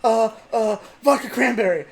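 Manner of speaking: high, loud panicked ordering; imitated panic. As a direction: blurt it out like a panicking friend. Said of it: "I see the panic in my friend's eye".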